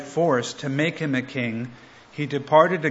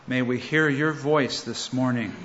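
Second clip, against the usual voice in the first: soft, reverent prayer voice; 3 semitones lower, 3 dB quieter than usual. Real, not acted. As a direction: pray humbly, easing off at the end. Closing lines of prayer.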